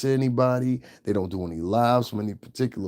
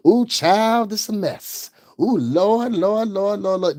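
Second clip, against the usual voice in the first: hammed-up plantation mammy, much higher and louder; mocking mammy voice; 8 semitones higher, 4 dB louder, slower than usual. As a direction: wail "Lord, Lord" like an exaggerated Southern mammy. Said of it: "Don't you hear the mammy effect".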